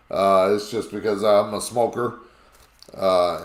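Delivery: ordinary delivery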